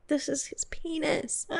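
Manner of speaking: slow hushed wonder, almost whispered; awed murmur. Retold or imitated. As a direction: murmur it slowly in awe. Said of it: "going, like".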